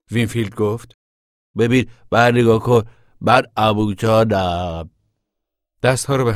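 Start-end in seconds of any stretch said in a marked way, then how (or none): 1.6-2.8 calling out. Pretend. Child calls out to sister. 3.2-4.9 put-on slow, childish drunk act; boy imitating his drunk uncle, slow and hammed-up; mock drunk uncle.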